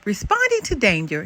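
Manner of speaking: ordinary delivery, nothing marked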